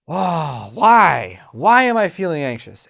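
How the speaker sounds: loud exasperated demanding why; frustrated loud self-questioning; impatient why. Retold or imitated. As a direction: demand it, fed up with yourself. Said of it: "pay attention to the tone of that questioning".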